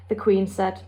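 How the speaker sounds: ordinary delivery, nothing marked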